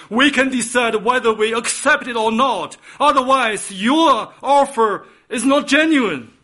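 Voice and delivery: loud, high impassioned preaching; fervent preaching. Acted. A preacher urging the congregation, fervent and loud. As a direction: drive the point home at full force.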